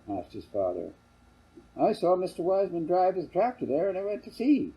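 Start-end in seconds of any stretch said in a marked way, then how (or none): 1.8-4.7 high voiced young boy; child's voice. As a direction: answer like an eager kid. Child answers his father.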